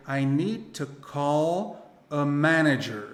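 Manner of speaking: slow model sentence, evenly spaced; careful, drawn-out English example; word by word. Shown as a demonstration. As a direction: dictate it slowly for learners.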